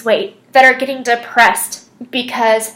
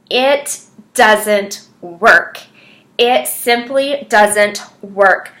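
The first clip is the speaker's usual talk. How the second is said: each word laid down slowly and firmly; slow it right down and let the point land; slow emphatic; 2 semitones lower, much slower than usual. Real, not acted. Coach telling other coaches why desperation fails, slow and emphatic. Coach stressing her key lesson.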